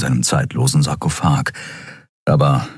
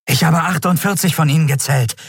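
higher-pitched, lightly breathless voice; breathless report; 10 semitones higher than usual. A narrator's reading. Yadi scout reporting enemy numbers to his admiral, breathless. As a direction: report it urgently, a little out of breath. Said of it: "berichtete der Yadi atemlos".

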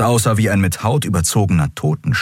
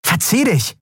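angry snap; 14 semitones higher than usual. Acted. Endriel drives the dealer away.

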